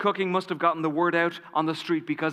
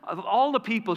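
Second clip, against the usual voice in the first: delighted, much higher voice; bright and smiley; 9 semitones higher than usual. Retold or imitated. Cook's wife hearing of the party plan, bright and delighted. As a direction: gush with joy at the news. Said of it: "all bright and smiley".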